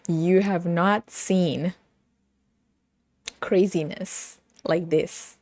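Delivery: slow, weighty, spaced out; slow emphatic. Real, not acted. Telling viewers about the series, slow emphatic insistence. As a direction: lean on each word to convince. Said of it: "emphatic slow build".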